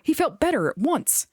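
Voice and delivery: plain reading voice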